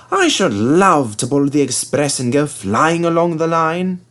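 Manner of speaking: a touch high and dreamy; lightly dreamy James. A narrator's reading. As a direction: dream of flying along. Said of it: "said James".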